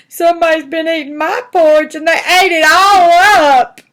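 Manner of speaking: high, loud wailing little bear; hammed-up tearful child's whine; crying Baby Bear. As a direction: bawl it out, stretching the last words. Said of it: "and Baby Bear's crying".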